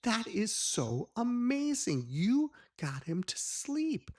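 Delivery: high, loud, slow gushing amazement; excited praise. Retold or imitated. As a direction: gush over it like a thrilled kid. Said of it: "I said".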